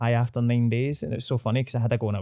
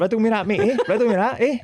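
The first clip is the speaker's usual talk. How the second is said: higher, louder mock taunt; hammed-up cocky taunt; 10 semitones higher, 5 dB louder than usual. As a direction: relish the comeback, teasing. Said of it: "repeating the line, louder and higher".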